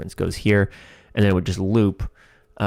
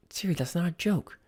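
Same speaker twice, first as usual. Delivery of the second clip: much higher and much softer, a meek little character voice; small high put-on voice; 7 semitones higher, 9 dB quieter than usual. Writer acting out the comic's Siri line in a soft high voice.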